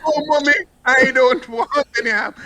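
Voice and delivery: put-on high, pleading child voice; high mock pleading son; whining son. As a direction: beg mommy like a child. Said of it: "son's reply voiced".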